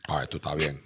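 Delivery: put-on low, soft Cuban-accented voice. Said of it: "I just put a Cuban voice on there".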